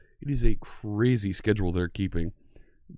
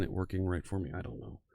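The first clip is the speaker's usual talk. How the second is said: muttered; 5 semitones lower, 12 dB quieter than usual. Real, not acted. Frustrated at broken tech.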